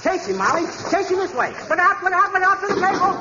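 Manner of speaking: frantic chase calls; excited shouting. Acted. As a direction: shoo the owl out in a flurry. Chasing an owl out.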